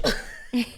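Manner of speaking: silent wheezing laugh; real wheeze of laughter; breathy laugh. Real, not acted. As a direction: laugh under your breath. Laughing at own story.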